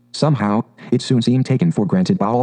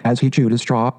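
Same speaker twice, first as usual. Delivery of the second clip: usual speaking voice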